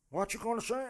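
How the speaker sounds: high-pitched attempt at Trump's American accent; failed Trump accent. Comic attempting Trump's accent, badly and high. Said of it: "I can't, I can't do his accent".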